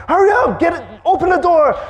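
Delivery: high, loud frantic hurry; panicked urging. Retold or imitated. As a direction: shout at the friend with the key. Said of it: "We're like".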